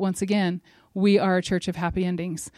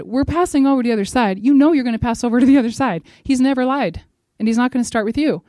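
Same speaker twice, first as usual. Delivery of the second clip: higher, louder, driving sermon climax; fervent preaching; 5 semitones higher, 6 dB louder than usual. Acted. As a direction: build the promise with rising fervour. Preaching at full force.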